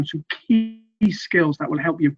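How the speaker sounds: ordinary talk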